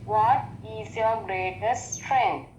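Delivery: normal talking voice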